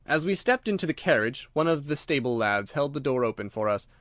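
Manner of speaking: ordinary delivery, nothing marked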